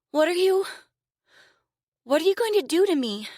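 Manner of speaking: high, loud, fast fearful question; frightened girl. A narrator's reading. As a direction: panic over what comes next. Caged girl fears captor.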